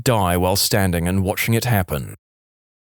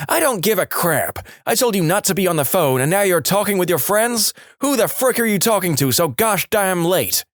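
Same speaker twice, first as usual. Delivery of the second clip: angry mom; 8 semitones higher than usual. Retold or imitated. Mum's outburst continues.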